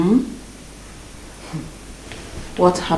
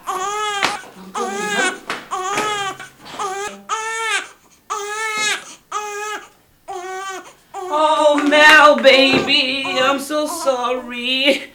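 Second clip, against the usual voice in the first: put-on soothing child; 7 semitones higher, 3 dB quieter, slower than usual. Mother calming child.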